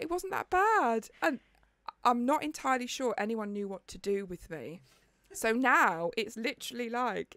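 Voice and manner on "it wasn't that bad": dismissive friends. Retold or imitated. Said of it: "the girls were like".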